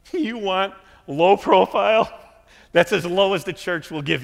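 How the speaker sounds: laugh, then lean into it big; laughing emphatic tease. Acted. Preacher teasing nursery volunteers, laughing and loud.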